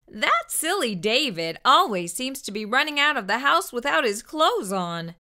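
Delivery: ordinary delivery, nothing marked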